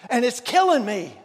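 loud, pleading cry to God; a preacher crying out in anguish. Retold or imitated. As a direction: cry it out as if forsaken. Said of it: "And he's crying out".

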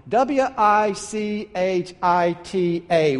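letters said slowly one by one; spelled out. Acted. Camper spelling the signpost to the traveler, letter by letter. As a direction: spell each letter W I C H I T A deliberately. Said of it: "Spell it for me".

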